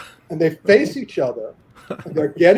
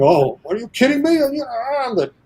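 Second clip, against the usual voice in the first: cocky Sammo; 6 semitones higher than usual. Retold or imitated. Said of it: "Sammo being Sammo's going".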